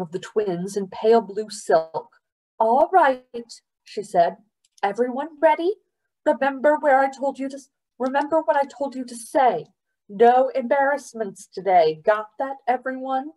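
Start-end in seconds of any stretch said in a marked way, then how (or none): 2.6-13.3 higher brisk mother; rally the family firmly. Acted.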